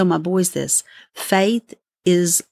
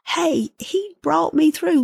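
bright, much higher and slow; joyful testimony; 9 semitones higher than usual. Pretend. A believer tells people, high and joyful. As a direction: announce it with delight.

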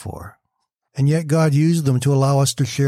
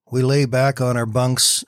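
ordinary delivery

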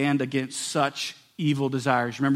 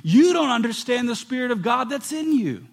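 loud, high pulpit preaching; fervent preaching; 9 semitones higher, 5 dB louder than usual. Acted. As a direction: preach it hard, pushing each line. Preaching at full force.

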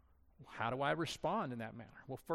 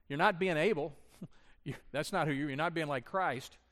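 ordinary talk, nothing marked

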